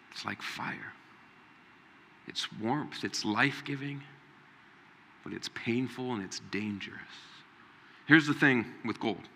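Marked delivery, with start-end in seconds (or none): 0.1-0.9 whisper. Acted. Preacher repeats key line softly. 2.3-7.0 soft, hushed. Acted. Preacher continues softly.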